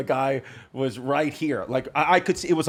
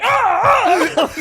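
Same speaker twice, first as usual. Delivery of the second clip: mock pained cries; 6 dB louder than usual. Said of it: "his body was just like".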